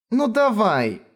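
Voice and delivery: high cartoon princess coaxing; sweet chirp. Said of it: "Сладко прощебетала она".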